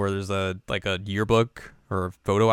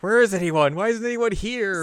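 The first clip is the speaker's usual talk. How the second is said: mock panic; 11 semitones higher than usual. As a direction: call out while fleeing. Girls fleeing the dog.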